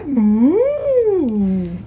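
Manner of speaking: loud, drawn-out mooing cow. Acted. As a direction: stretch the moo like a cow. Cow offers her moo.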